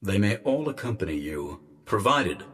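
measured, quiet condition from an official; disembodied guardian voice. A narrator's reading. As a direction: grant it softly, with formal reserve. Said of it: "Presser said at last".

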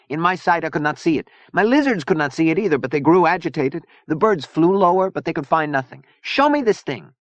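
put-on high, accented, emphatic voice; fiery Zona, agitated. A narrator's reading. Zona demanding to see the intruder, agitated. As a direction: build to an insistent command.

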